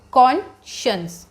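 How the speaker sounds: "Conscience" in two parts, KON... shuns; correct pronunciation. Shown as a demonstration. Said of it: "इसको कैसे pronounce करना है?". ("Conscience" का सही उच्चारण, कॉन... शंस)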